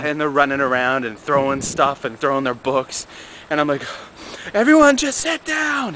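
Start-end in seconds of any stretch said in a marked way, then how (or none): 4.5-5.9 high mock yell for order; yelling teacher. Teacher yelling at wild kids to sit down, high mock yell. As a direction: call the whole room to sit, up high. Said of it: "And I yell".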